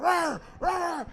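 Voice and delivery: high, growling dog barks; imitated barking. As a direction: bark like a charging Rottweiler. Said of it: "by now he's barking".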